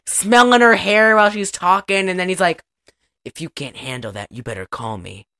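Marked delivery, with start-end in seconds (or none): 3.2-5.3 low, soft creepy come-on; creepy pervert. Retold or imitated. Dad smelling her hair while she's talking, mock creepy pervert. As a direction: murmur it low like a lecherous dad. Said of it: "His dad is also a pervert".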